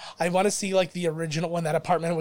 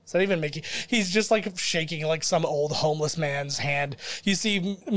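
ordinary talk

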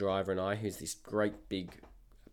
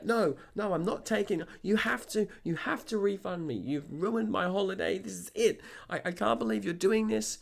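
much higher, frantic imitation of a complainer; pitched-up mock hysterical protest; highly upset customer; 10 semitones higher than usual. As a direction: mimic her mounting outrage. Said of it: "into highly upset customer".

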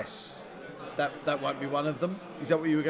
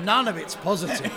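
ordinary talk

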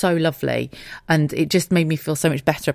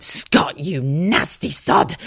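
mock scolding; much slower than usual.

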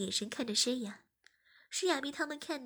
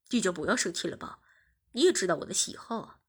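deeper, loud cajoling; lower coaxing boyfriend; 5 semitones lower, 5 dB louder than usual. Acted. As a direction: coax her out of it. (低声哄劝)

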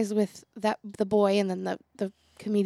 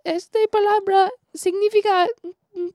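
broken Spanish; 10 semitones higher, 8 dB louder than usual. Retold or imitated. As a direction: struggle through it in broken Spanish. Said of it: "in my broken Spanish".

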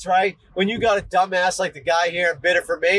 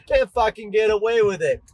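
usual speaking voice

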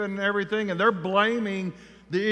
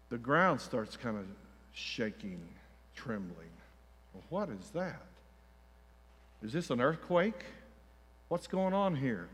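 much softer, dropping low; preacher setting a tense scene, hushed and low; hushed suspense; 8 semitones lower, 12 dB quieter than usual. A narrator's reading. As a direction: draw listeners in with dread. Suspense as army approaches.

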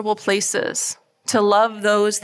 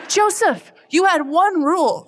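a much higher, mock-scolding mom; exasperated mom; 8 semitones higher than usual. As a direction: scold him with laughing disbelief. Mom scolding son, retold laughing.

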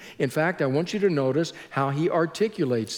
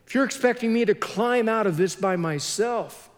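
higher-pitched, halting appeal to God; mildly acted desperation, pitched up; despairing plea; 4 semitones higher than usual. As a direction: act out helpless pleading. Voices the psalmist's despairing plea.

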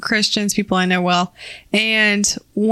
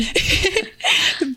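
burst of laughter; 7 semitones higher than usual. Real, not acted. Laughing at her own story.

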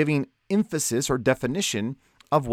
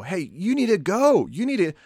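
higher-pitched, insistent preacher's exhortation; emphatic urging; 6 semitones higher than usual. Retold or imitated. Preacher urging the congregation to get out on mission, emphatic. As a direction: push each call to go, imitating urgent sermons. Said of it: "emphasizes this idea of go".

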